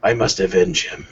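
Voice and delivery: lightly voiced low growl; mock brooding hero; low gravel vigilante growl. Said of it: "that gravelly voice".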